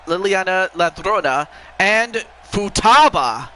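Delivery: slow, formal ring announcer; wrestling commentator. Acted. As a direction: draw out the big announcement. Reads out the champions.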